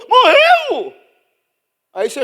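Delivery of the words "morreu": high, loud gasping question; hammed-up shock at the news; shocked disbelief. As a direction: gasp out the question.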